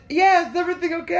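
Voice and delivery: high, loud, quick call back; imitated girlfriend. Girlfriend calling back from the pool, imitated shout. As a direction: mimic her shouting back from afar. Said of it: "And she goes".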